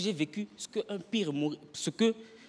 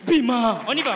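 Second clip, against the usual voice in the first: loud, high yell of Bima; a crowd telling the artist to go away in Lingala; shouted Bima; 5 semitones higher, 9 dB louder than usual. Retold or imitated. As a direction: chase the performer off. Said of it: "On te fait".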